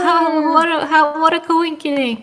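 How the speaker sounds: high-pitched in-character voice; feigned surprise. Acted. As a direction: play up a chance meeting. Player acts a chance meeting.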